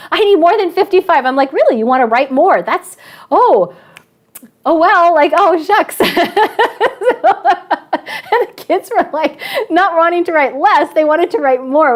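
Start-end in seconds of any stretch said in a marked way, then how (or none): 0.1-1.3 put-on higher, louder voice of a protesting kid; complaining kid. A student complaining to the teacher about the word limit, higher and louder. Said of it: "kids are mad at me". 1.5-6.0 higher and louder, hammed-up disappointment; mock dismay. The teacher answering the students with hammed-up mock regret. 6.0-11.9 higher, giggling as she speaks; laughing. Real, not acted. The teacher telling the audience, giggling through the line.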